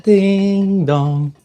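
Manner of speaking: high singsong ding dong; mock doorbell. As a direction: chime it like a doorbell. Doorbell sound for show intro.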